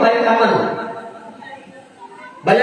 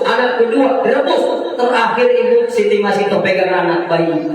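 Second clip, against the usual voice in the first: ordinary delivery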